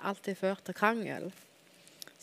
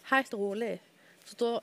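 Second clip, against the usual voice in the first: ordinary talk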